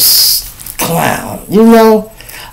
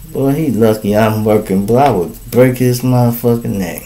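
muttering to self; 4 semitones lower, 5 dB quieter than usual. Acted. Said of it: "you say yourself".

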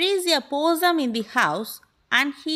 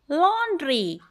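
ordinary delivery

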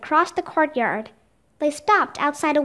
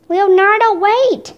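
calling out high and loud; shout; 5 semitones higher, 6 dB louder than usual. A narrator's reading. Annie calls after Leonardo as he hurries off, shouting. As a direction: shout after someone getting away. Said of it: "Annie shouted".

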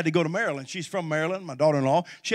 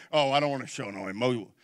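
mock gruff man; 4 semitones lower, 3 dB quieter than usual. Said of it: "Most men, we're not".

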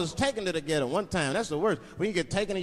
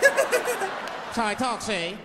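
high, drawn-out gangster voice; defiant old-movie crook, hammed up; forties bad guy; 5 semitones higher, 4 dB louder, much slower than usual. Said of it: "that's how bad guys used to talk".

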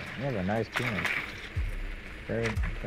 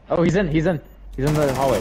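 louder, higher warning to a teammate; urgent callout; 5 semitones higher, 5 dB louder than usual. Real, not acted. Warning teammate of an enemy in the hallway, urgent callout. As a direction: call out an enemy sighting urgently.

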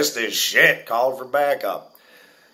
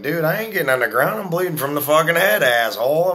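arguing back, higher and louder; raised-voice retort in a retelling; retold defiance; 8 semitones higher, 4 dB louder than usual. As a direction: act out an indignant refusal to a cop. Retelling defiant argument with cops.

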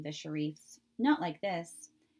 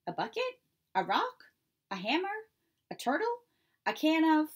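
much higher, quick rapid-fire guesses; eager guessing; 9 semitones higher, 4 dB louder than usual. Acted. Robot guessing what the children's gift is, eager and high. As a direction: fire off guesses, each one brighter.

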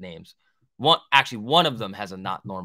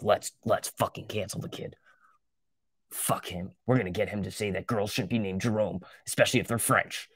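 whispered, scheming like a plotter; mock conspiratorial whisper; 3 semitones lower, 4 dB quieter than usual. Pretend chat scheming to cancel the streamer, mock whisper. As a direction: hush it like a villain hatching a plan.

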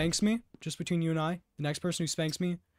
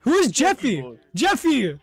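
calling out; 6 semitones higher, 6 dB louder than usual. Real, not acted. Calling out to a player.

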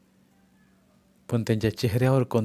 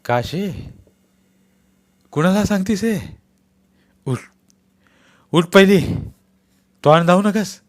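higher, louder furious rebuke; angry shout; 8 semitones higher, 7 dB louder than usual. Acted. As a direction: shout her down. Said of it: "रागावून मोठ्यानं ... ओरडले".

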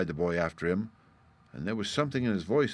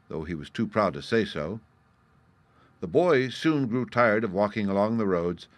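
usual speaking voice

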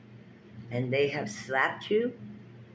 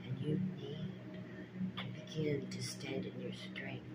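whisper; 2 semitones lower, 11 dB quieter than usual. Real, not acted. Urging viewers toward strength.

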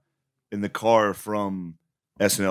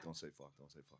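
muttered under the breath, much softer; 8 semitones lower, 31 dB quieter, faster than usual. Retold or imitated. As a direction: say it to yourself, barely audible. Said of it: "I was like".

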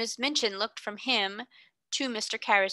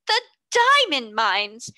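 louder, slow gasping shock; breathless disbelief at the news; gasped out; 4 semitones higher, 8 dB louder, slower than usual. A narrator's reading. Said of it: "Miss Minchin gasped out".

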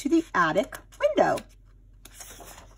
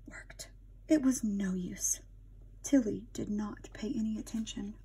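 whispered narration; 10 dB quieter than usual. A narrator's reading.

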